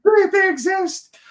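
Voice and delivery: high, slow, stunned squeal; buck fever. Retold or imitated. Marvelling to the hosts that real deals exist, high stunned squeal. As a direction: stretch it out, overwhelmed by the find. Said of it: "That's me. I'm like".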